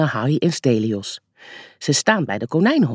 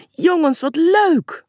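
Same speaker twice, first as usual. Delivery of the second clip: high, loud happy exclamation; delighted cry; 4 dB louder, slower than usual. A narrator's reading. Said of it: "roept ze". (blij uitroepen, hoog en luid)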